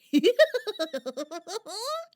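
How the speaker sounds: loud, high, trilling hoot; wavering creature call. Acted. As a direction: let out a long wobbly hoot. Mysterious creature cries out.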